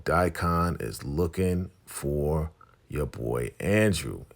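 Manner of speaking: ordinary talk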